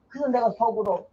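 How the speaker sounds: ordinary talk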